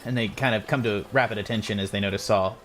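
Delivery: ordinary talk, nothing marked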